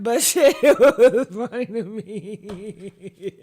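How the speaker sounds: slow words broken by laughter; laughing. Real, not acted. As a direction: let the laugh take over. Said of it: "I don't know why I laughed".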